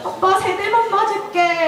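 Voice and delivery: high, drawn-out imitation of a crying child; mock tearful bargaining. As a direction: plead for a lighter punishment, whiny and hammed-up. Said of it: "이랬고 막 울었어요". (울먹이며 사정하는 아이 흉내)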